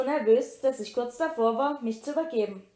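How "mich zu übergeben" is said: ordinary delivery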